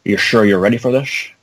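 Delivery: slurred-s Scottish movie-hero impression; quoting a movie tough guy in Scottish brogue; Sean Connery. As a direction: put on a Scottish film veteran's voice. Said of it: "Sean Connery turns to Nicolas Cage saying".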